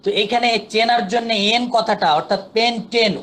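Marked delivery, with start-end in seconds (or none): 2.5-3.2 পেন্টেন pronounced with "en" like the letter N; teacher showing the "en" sound in পেন্টেন; clear "en" ending. Shown as a demonstration. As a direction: bring out the "-ten" at the end of পেন্টেন. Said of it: "উচ্চারণটা এন এর মতো হচ্ছে".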